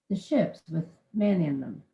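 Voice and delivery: usual speaking voice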